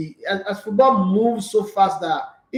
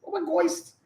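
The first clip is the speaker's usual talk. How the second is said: much higher mock girly squeal, oh my ghost; mocking fake Nigerian girls; 14 semitones higher than usual. Host mocking fake Nigerian girls on air, much higher squeal. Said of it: "fake Nigerian girls".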